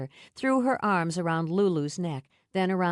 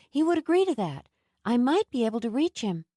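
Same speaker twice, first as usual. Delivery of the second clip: put-on higher, eager young woman; eager, pleading; 6 semitones higher, 4 dB louder, faster than usual. A narrator's reading. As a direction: press the offer hopefully. Nell pleading to help.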